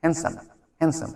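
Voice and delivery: "হ্যান্ডসাম" said hen-sam with no d; drilling "হ্যান্ডসাম" as hensam; pronunciation drill. Shown as a demonstration. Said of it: "উচ্চারণটা কিন্তু হ্যান্ডসাম না".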